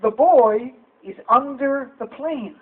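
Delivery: slow, careful language-tape recital; mock language lesson, word by word, slowly; language tape phrase. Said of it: "they taught me word for word on the tape".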